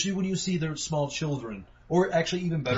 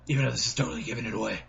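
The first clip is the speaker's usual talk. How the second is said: normal talking voice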